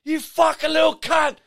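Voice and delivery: high-pitched mock furious shout; acted-out high angry holler; imitated road-rage yell. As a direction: yell like an enraged driver. Acts out raging driver.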